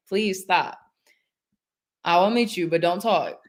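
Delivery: ordinary delivery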